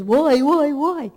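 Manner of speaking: high, loud wailing cry of why; anguished scream up to heaven; screaming to God. Retold or imitated. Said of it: "when he was screaming to God".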